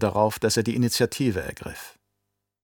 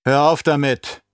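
loud, higher-pitched order; raised voice, ordering him to stop; commanding; 4 semitones higher, 6 dB louder than usual. A narrator's reading. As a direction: cut in with a firm command. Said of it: "befahl Kinet".

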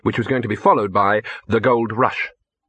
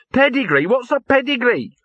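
indignant; 13 semitones higher than usual. Acted. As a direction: scoff at the very idea. Gaspode indignant about pedigree.

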